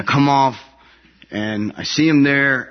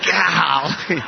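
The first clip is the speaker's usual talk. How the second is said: mock golly; 5 semitones higher than usual. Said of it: "I'm like".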